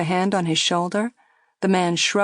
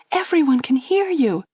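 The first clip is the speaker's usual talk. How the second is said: anxious; 7 semitones higher, 3 dB louder than usual. A narrator's reading. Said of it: "looking around her anxiously".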